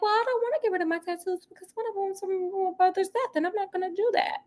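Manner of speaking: high, soft voice for Vea standing firm; quoting Vea. Retold or imitated. Recapping Vea to viewers, high and soft. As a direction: lift the voice up and hold your ground politely. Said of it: "So Vea hold her own".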